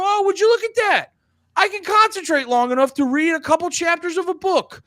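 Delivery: mocking himself for being amazed he can read a book; mock delighted self. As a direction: gush like a proud fool. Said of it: "And I go".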